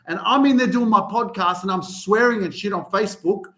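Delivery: ordinary delivery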